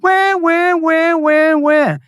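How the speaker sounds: high, fake "wah wah" baby wail; mock baby crying. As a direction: bawl "wah" like a mocked toddler. Mocking [name] as crybaby.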